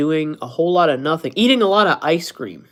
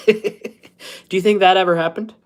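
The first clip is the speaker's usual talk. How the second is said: ordinary talk, nothing marked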